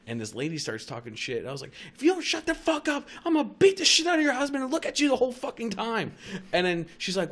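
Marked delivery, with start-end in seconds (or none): 1.9-6.1 high, fast, loud tirade; re-enacted screaming threat. Threatening a lady who started talking, re-enacted furious screaming. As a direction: retell a threat as a rising frantic yell. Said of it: "Like, just screaming".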